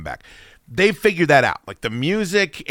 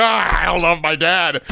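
higher, hammed-up tearful wail; exaggerated crying voice; mock sobbing; 5 semitones higher, 4 dB louder than usual. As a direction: wail it as if sobbing, played for laughs. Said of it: "I started fucking just sobbing".

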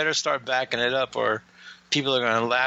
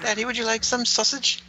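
high, teasing put-on voice, much higher; coy mock offer; 10 semitones higher than usual. Cohost teasing host about sausage, high coy put-on voice. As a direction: sweetly offer the sausage to daddy as a joke.

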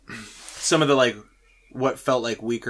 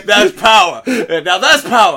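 mob boss quote; 7 semitones higher, 9 dB louder than usual. Retold or imitated.

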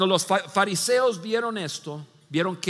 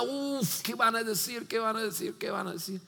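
soft, fading anxious repeats; worried fretting; 8 dB quieter than usual. Retold or imitated. Companions fretting over what people will say, getting softer. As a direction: let the worry shrink to a murmur.

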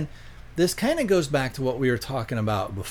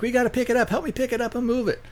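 much higher, hurried mock pleading; imitated urgent plea; 12 semitones higher, much faster than usual. Woman begging the hunter to help move the log, mock urgent.

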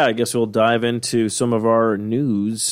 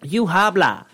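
silly; 8 semitones higher, 4 dB louder than usual. Pretend. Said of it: "technical term for it?".

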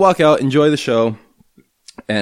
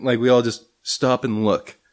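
ordinary talk, nothing marked

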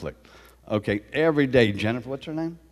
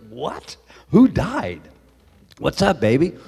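alarmed; 7 semitones higher, 6 dB louder, slower than usual. Retold or imitated. He answers the emergency call.

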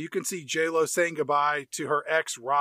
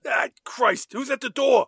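mock exasperation; 6 semitones higher, 4 dB louder than usual. Imitating exasperated Affleck.

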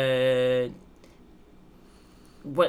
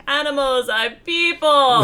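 mock exclamation; 9 semitones higher, 7 dB louder than usual.

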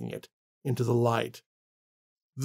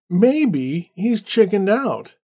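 smug drawl; 6 semitones higher, 4 dB louder, much slower than usual. Acted. Randall mocking David's absence.

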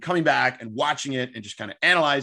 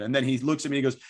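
usual speaking voice

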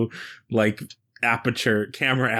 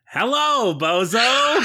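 lightly voiced robot, higher and bright; a cheery robot's hello; robot greeting; 11 semitones higher, much slower than usual. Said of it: "Johnny Five is gonna be like".

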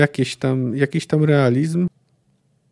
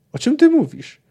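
pitched much higher in disbelief, stress on ty; incredulous question; 11 semitones higher than usual. Acted. Geralt stunned by Cahir's confession, much higher disbelieving question. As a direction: ask it as if you can't believe your ears.

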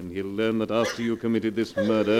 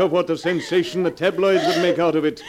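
loud, higher, relentless; cruel taunting, building; 5 semitones higher, 5 dB louder than usual. Acted. Blackmailer tormenting wife with exposure, loud cruel taunts. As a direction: hammer her with the consequences.

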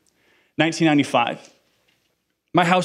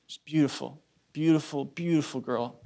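tender; 7 dB quieter than usual. Real, not acted. Holding newborn niece Elizabeth.

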